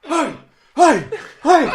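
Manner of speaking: high, loud party cheers; mock cheer. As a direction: whoop it up like a rowdy pallbearer. Cheering, carrying the coffin.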